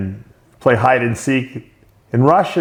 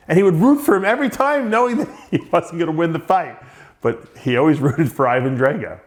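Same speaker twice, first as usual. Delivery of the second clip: higher-pitched, laughing through the words; amused laughing; 6 semitones higher than usual. Real, not acted. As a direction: tell it while breaking into laughter. Laughing at fond memory of friend.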